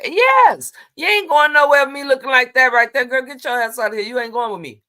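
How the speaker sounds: loud, fast, high telling-off; loud fast "get your ass out of here" scold; scolding mother. Retold or imitated. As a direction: bark it at her like a fed-up mom. Voices her mother scolding her outfit.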